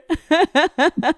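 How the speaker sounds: quick high laughter. Real, not acted. Laughing about becoming an outlier. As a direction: laugh at the idea.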